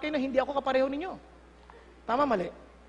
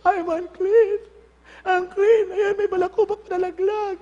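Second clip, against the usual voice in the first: much higher, louder hammed-up wail of unclean; mock leper cry; 6 semitones higher, 6 dB louder than usual. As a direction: ham up a pitiful outcast crying out. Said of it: "Sabi ko". (kunwaring iyak ng ketongin)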